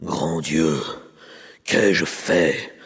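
low ogre voice in dismay; a giant horrified at his own deed; deep ogre exclamation of horror; ogre crying out. A narrator's reading. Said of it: "s'écria-t-il".

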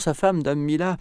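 plain reading voice

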